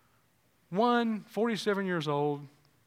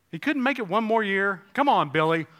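fervent preaching; 6 semitones higher, 9 dB louder than usual. Acted. Preaching at full force.

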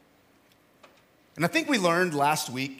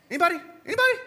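sharp high rising call to the crowd; 12 semitones higher, much faster than usual. Real, not acted. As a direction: call out to the room, pitched way up. Calling out to audience.

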